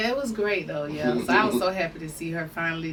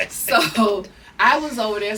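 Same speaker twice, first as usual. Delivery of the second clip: 1 laughing; 7 dB louder, slower than usual. Real, not acted.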